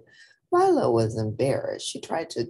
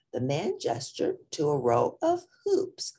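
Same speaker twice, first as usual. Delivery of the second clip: usual speaking voice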